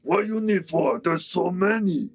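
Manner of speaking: orc voice. Acted. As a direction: argue like a simple brute. GM voices orc arguing with Drax.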